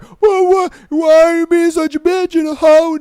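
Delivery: high, loud mock outburst of the ex; a jeering impression of a furious boyfriend; mocking angry ex. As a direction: ham up the ex yelling. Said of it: "he like".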